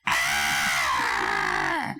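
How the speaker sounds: sudden low yell of horror; horrified yell. Retold or imitated. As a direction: cry out as the plug is crushed. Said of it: "and like".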